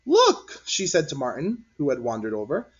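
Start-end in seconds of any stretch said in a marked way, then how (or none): 0.0-0.6 high, loud call of look; eager kid pointing something out; excited child. A narrator's reading. Child character exclaims.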